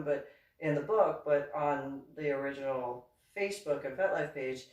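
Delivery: ordinary delivery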